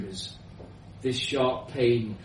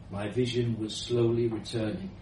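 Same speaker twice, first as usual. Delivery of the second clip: plain reading voice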